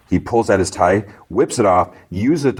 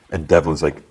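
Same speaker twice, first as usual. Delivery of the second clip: normal talking voice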